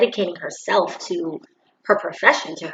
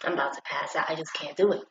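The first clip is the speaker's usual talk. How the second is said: low, much softer groaning complaint; groaning to the boys; 5 semitones lower, 12 dB quieter, faster than usual. Retold or imitated. Guy telling his boys, low soft groan. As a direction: grumble it low to your friends. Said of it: "you're like".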